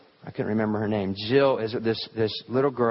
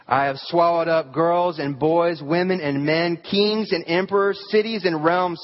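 the lion speaking; 6 semitones higher than usual. Acted. Preacher reading Aslan answering Jill, a raised matter-of-fact voice. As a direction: let the lion state it plainly. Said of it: "didn't say it as if it were boasting".

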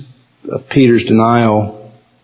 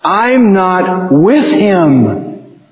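slow, understated bitter denial; mild voicing of Peter's curse, slow; Peter denying; 4 semitones higher, much slower than usual. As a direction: spit out the denial slowly. Peter cursing Jesus, voiced.

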